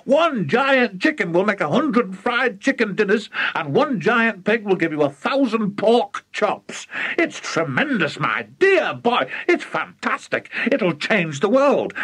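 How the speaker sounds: high, gushing enthusiasm; overexcited father marvelling at his scheme; excited cry. Acted. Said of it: "cried Mister Cranky".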